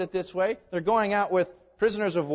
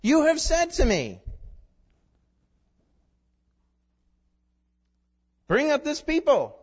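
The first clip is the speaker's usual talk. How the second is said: raised, pleading Moses to God; earnest Moses; 10 semitones higher, 5 dB louder than usual. Acted. Moses pleads with God: earnest, raised voice. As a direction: Moses pressing God to listen. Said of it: "Look at the, what he actually says".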